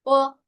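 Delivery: "Fo" held level and high in first tone; first tone demo. Shown as a demonstration. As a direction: sustain "Fo" on one steady high pitch. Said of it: "con el primer tono se siente más".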